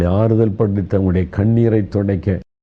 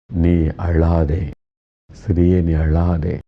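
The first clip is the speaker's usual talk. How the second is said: slow, tender, reassuring; comforting words; 2 semitones lower, slower than usual. Retold or imitated. Jesus consoling the grieving widow, slow and tender. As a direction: soothe a weeping widow gently and slowly. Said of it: "ஆறுதலான வார்த்தை சொல்லுகிறார்".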